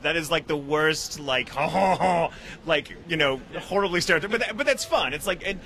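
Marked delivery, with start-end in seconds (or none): none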